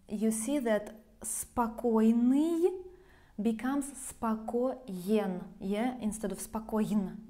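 normal talking voice

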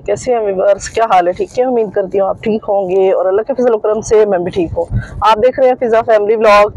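ordinary talk, nothing marked

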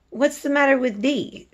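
teacher voicing a maid in a read-aloud; Martha's Yorkshire voice. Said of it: "she asked".

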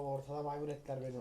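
ordinary delivery

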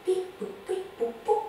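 high playful beep boop; be the spaceship controls; playful computer beeps for the children; robot beeps. Acted. Imitating control panel.

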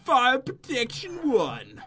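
long, drawn-out grand announcement; slow, showy reveal, high then dropping; trying to sound cool. Pretend. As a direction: draw every word out to sound epic. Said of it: "tried to make it sound cool".

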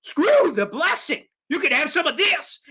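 a touch of taunting bravado; defiant retort. Retold or imitated. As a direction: throw the praise back with swagger. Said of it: "And Sal said".

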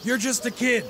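high-pitched angry shouting; furious dismissal. Acted. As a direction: shout him down and send him off. Boss shouts worker down.

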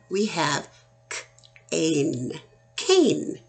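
teaching the long vowel; sounding out. Shown as a demonstration. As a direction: stretch the long A, then say cane brightly. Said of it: "instead of can".

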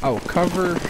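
ordinary talk, nothing marked

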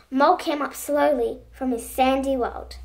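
ordinary delivery

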